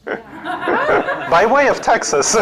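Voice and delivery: laughing through a joking comeback; high laughing reply, drawn out. Real, not acted. Answering the audience's Texas guess, laughing.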